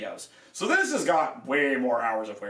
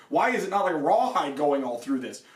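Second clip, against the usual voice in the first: ordinary talk, nothing marked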